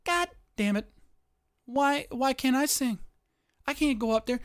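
high mock-whiny complaint, drawn out; playful high-pitched mock lament; whiny LeBron. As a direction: pretend to be a sulking star who wishes he could sing. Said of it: "LeBron going".

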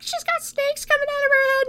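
put-on high, loud, excited kid voice; frantic caller. Teen reporting Medusa to 911, frantic high voice. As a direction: blurt it out like a panicking teen on the phone.

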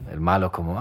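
ordinary talk, nothing marked

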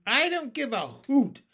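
loud indignant outcry; earthworm crying out. Acted. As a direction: protest it loudly. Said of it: "cried the earthworm".

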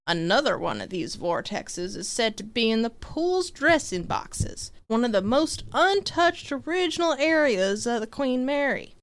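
a light Southern storyteller voice; Southern accent. Acted. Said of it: "Let me find a voice".

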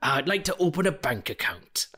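polite mock pirate brogue; pirate Seamus. Seamus opening a bank account, mock brogue. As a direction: greet the teller like a pirate. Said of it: "explain to people that he's a pirate".

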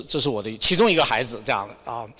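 ordinary talk, nothing marked

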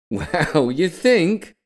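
loud, high sarcastic retort. A narrator's reading. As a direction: throw it back sarcastically, pitch up high. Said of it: "she said sarcastically".

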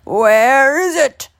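put-on high, loud, slow old man's holler. A narrator's reading. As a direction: holler the question, drawn out. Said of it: "hollered the old man".

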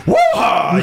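high, explosive rapper whoop. Acted. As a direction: shout it like a hype rapper.

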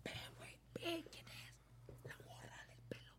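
susurro urgente al amigo. Retold or imitated. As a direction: susurrar para que ella no oiga. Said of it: "mi compa ... me dice". (el compa susurra junto al teléfono)

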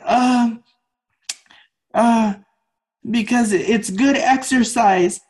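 high, hesitant, drawn-out voice; nervous answer. Retold or imitated. Dancer put on the spot by angry teacher, mock-nervous reply. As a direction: imitate a cowed student groping for an answer.

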